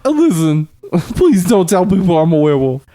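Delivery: high, pleading celebrity impression; John Travolta. Star phones to beg secrecy about being a werewolf, high John Travolta voice. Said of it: "he called me and he asked me".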